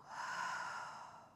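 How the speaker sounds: long audible open-mouth breath out; long exhale sigh. Shown as a demonstration. Yoga teacher breathing out with listeners, long open-mouth exhale. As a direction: let all the air go through an open mouth.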